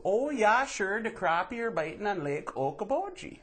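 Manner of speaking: high, bright mock Upper Midwest accent; hammy Minnesota fisherman accent, up high. Swedish immigrant's grandson boasts about fishing, mock Minnesota accent. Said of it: "That turns into".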